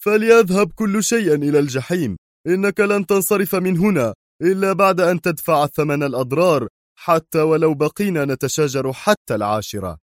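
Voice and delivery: high-pitched angry tirade; furious. Acted. Qadri refusing to let Bruce leave, furious. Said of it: "وهو يقول في غضب".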